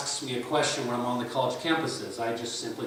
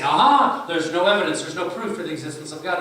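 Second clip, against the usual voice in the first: loud, high triumphant aha; gloating skeptic; 5 semitones higher, 10 dB louder than usual. Retold or imitated. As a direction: crow over catching him out. Skeptic voiced gloating over "no proof.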